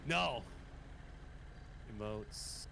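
normal talking voice

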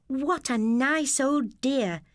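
fond little remark, drawn out; girl's voice. A narrator's reading. Sister praising the old lady, lightly voiced girl.

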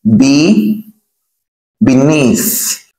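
BE alone, pause, then BENEATH; BE held up before BENEATH; syllable demonstration. Shown as a demonstration. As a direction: break off BE, then the whole BENEATH. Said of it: "We say be".

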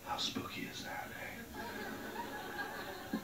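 hushed, eerie whisper; spooky whisper. Acted. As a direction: breathe it like a ghost story. Spooky remark after head voices.

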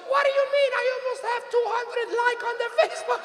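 very high, indignant mother voice; imitated mother. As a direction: object as Mom, high-pitched and put-on. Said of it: "And she goes".

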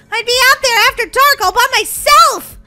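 kind of afraid. Pretend. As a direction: fret aloud, pitch lifted, playfully scared. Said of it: "I'm kind of afraid".

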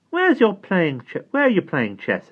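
put-on high curious child voice; girl's voice. A narrator's reading. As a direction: ask it as a curious little girl. Dido asks Simon.

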